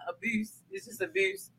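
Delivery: "abuse" as a soft uh-BYOOS, no r; correct pronunciation. Shown as a demonstration. Host denies saying it that way, correct pronunciation. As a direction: softly insist on "abuse" with a y-glide, uh-BYOOS. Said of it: "I don't say it like that".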